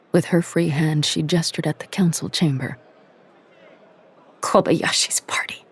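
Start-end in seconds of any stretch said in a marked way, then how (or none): 4.4-5.6 tight whispered fury; through tight lips. A narrator's reading. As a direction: hiss it through clenched teeth. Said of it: "through tight lips".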